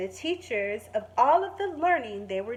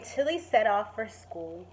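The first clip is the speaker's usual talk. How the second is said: plain reading voice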